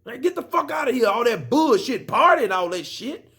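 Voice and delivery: hammed-up furious outburst at a rival; mock angry rant. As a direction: fire it off like a pissed-off fighter going off. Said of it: "he was pissed".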